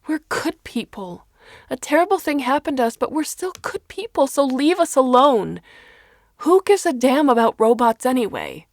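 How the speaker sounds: high, loud and fast, near hysterical; mother losing control before the press; desperate shout. A narrator's reading. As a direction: scream the plea at the cameras. Parent pleading at reporters.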